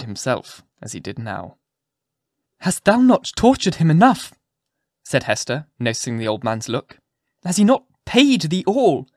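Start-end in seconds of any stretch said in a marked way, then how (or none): 2.6-4.3 high, loud reproach; impassioned protest. A narrator's reading. Hester confronts the old physician. 7.4-9.1 high, loud challenge; impassioned protest. A narrator's reading. Hester confronts the old physician.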